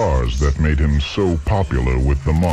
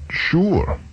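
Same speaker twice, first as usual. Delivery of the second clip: retold sure; 13 semitones higher than usual. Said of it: "I said".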